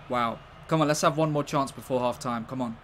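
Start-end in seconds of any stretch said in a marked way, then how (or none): none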